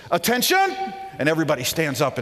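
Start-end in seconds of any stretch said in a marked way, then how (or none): 0.1-0.7 barked high, loud command; officer calling the troops to attention; military command. Pretend. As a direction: snap it like a drill sergeant. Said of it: "It's as if someone says".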